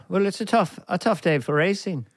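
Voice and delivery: lilting Irish demonstration; Irishman's musical accent. Shown as a demonstration. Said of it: "an Irishman might say".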